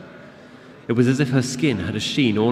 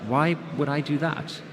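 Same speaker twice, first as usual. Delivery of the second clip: perplexed; 6 semitones higher than usual. Acted. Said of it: "She seemed genuinely perplexed".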